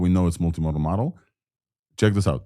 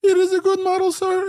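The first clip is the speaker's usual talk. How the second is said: squeaky falsetto, far higher. Shown as a demonstration. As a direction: jump up into a tiny high voice. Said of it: "When I speak with a high voice".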